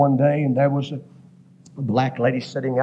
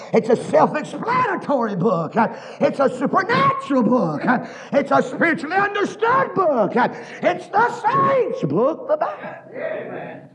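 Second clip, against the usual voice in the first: full-force preaching. Acted. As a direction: preach it out, climbing higher each line. Preaching, climbing higher and slower.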